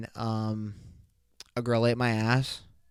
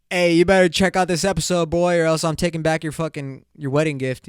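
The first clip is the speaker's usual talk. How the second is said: louder, higher, joking warning; mock threat; 4 semitones higher, 6 dB louder than usual. Shouting out Joey, mock threatening louder. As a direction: bark a playful threat at Joey. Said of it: "Hey, Joey, shout out".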